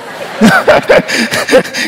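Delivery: a short, high, breathy laugh; high airy laugh. Real, not acted. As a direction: let a high, airy chuckle out. Laugh after his Gandalf line.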